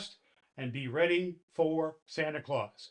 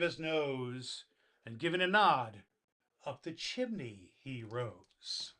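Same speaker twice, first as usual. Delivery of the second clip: put-on storybook reading to children; gentle bedtime poem reading; story narration. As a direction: read the Christmas poem warmly to kids. Santa reads the poem aloud.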